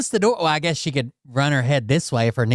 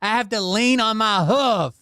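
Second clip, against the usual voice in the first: slow, higher mocking whine of her words; mocking imitation; 9 semitones higher, slower than usual. Man mocks his mother's slip to the deputy, higher and slow.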